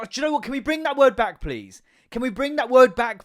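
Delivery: loud, high-pitched pleading rant; worked-up public appeal; impassioned plea. Real, not acted. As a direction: raise pitch and volume as if campaigning. Mock-earnest plea to revive word.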